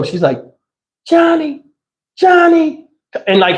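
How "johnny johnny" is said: Johnny, Johnny stretched long and high; slow, high, pleading call; slow drawn-out call. Retold or imitated. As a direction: call his name slowly, like grandma. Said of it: "She talks real slow".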